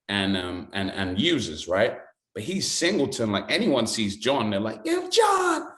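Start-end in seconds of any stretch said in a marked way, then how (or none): 4.9-5.7 high-pitched imitated street hail; calling out a greeting. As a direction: hail a well-known friend, voice leaping up. Locals calling out greeting to John.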